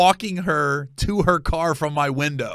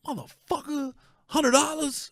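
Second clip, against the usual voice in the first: much higher, incredulous mock anger; retold angry outburst; 12 semitones higher than usual. Comedian reliving his anger over the hundred dollars, pitched high. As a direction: replay the fury over losing the cash. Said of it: "I'm pissed".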